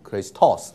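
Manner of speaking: Christos with "tos" punched and falling; stress on last syllable. Shown as a demonstration. Teacher shows stress on final syllable, "tos" of Christos stressed. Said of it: "重音它发在尾音节啊".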